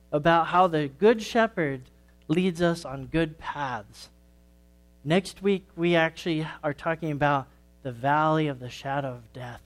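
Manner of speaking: usual speaking voice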